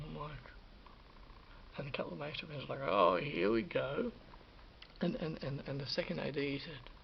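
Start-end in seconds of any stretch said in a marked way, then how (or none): 2.9-4.1 slow, louder jeering drawl; mock teasing. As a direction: tease him slowly, like mates ribbing. Mates teasing, slow loud drawl.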